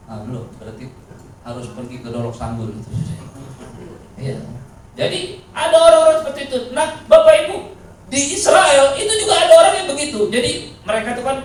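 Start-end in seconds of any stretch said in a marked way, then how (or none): 5.0-11.4 loud, high preaching to the congregation. Acted. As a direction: proclaim it to the whole church. Preaching at full force.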